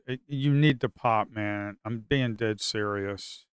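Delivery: ordinary talk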